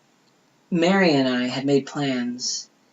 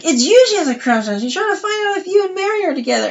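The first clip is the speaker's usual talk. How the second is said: much higher, louder, quick excited telling; gleeful gossip; 5 dB louder than usual. Retold or imitated. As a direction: spill the secret breathlessly. Voicing Cheryl's excited reveal.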